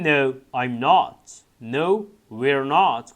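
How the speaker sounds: each word stretched out; very slow model reading. Shown as a demonstration. Teacher reading the answers to students, very slow. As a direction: spell the answers out slowly. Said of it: "teacher models textbook answers".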